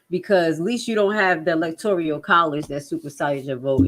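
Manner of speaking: ordinary talk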